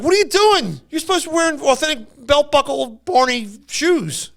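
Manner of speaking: high, loud disbelieving scold; mock outrage at the wrong shoes; hammed-up indignant scolding; outraged reenactor. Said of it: "And Al's like".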